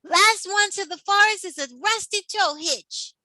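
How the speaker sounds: high, teasing race dare; cheeky excited dare; playful challenge. A narrator's reading. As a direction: dare your buddy to race.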